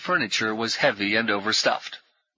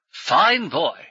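brisk, higher, jovial military gent; hearty ex-officer; 7 semitones higher, 5 dB louder than usual. Acted. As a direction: bluff old soldier greeting new friends. Brummond praises the boy.

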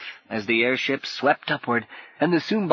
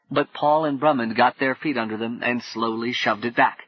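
plain reading voice

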